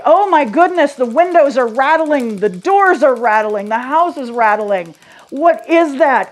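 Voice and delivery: loud, high, startled cry at rattling windows; alarmed. Retold or imitated. Someone in bed startled by the earthquake, alarmed. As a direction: panic as the house shakes.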